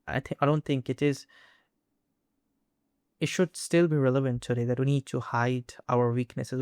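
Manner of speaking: ordinary talk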